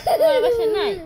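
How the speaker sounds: high, loud mock disbelief; surprised. Real, not acted. Mother to child who claims no home, surprised.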